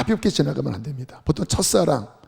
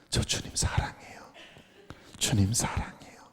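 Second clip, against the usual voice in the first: soft, lower whispered love; whispered confession; 7 semitones lower, 10 dB quieter than usual. Retold or imitated. Brother ends his prayer to the Lord, whispering. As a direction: whisper it, dying away. Quoting a dying man's prayer.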